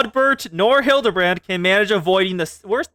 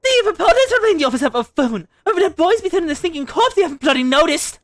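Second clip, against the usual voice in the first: a higher put-on British accent, half laughing; 9 semitones higher, faster than usual. Streamer reading game dialogue in a put-on British accent. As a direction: play the British-accented character, a bit higher. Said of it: "I can do the accent".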